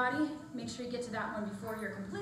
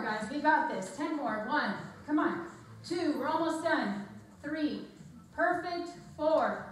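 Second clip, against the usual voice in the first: ordinary delivery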